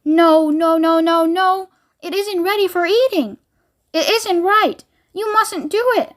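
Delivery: anxious high pleading; distressed. A narrator's reading. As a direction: beg her to stop. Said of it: "wringing his hands and saying".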